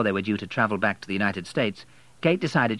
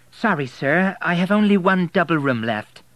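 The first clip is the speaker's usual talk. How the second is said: higher, polite hotel receptionist voice; apologetic desk clerk, pitched up; 8 semitones higher, 5 dB louder than usual. A narrator's reading. Receptionist telling William only one room is left, higher and polite.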